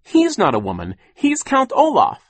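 high, urgent correction; insistent high outburst; bursting out. A narrator's reading. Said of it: "Violet burst out".

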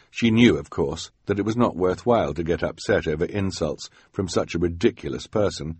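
usual speaking voice

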